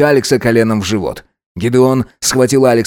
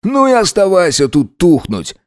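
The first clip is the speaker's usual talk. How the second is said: higher-pitched scornful dismissal; contemptuous; 7 semitones higher than usual. A narrator's reading. Alex gives up on his lover, contemptuous. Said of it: "презрительно глядя на него".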